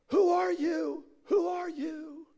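very high, long-held call; hammed-up holler to an unseen voice; desperate calling. Man on cliff questioning the voice, high wailing call.

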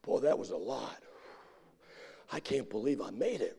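low, winded, puffing between phrases; out of breath. Retold or imitated. Churchgoer worn out on Sunday morning, winded puffing. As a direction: act exhausted and panting. Acts exhausted newcomer catching breath.

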